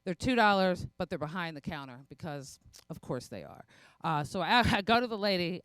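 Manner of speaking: ordinary talk, nothing marked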